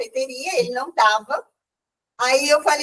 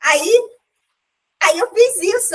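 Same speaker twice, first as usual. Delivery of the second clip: ordinary talk, nothing marked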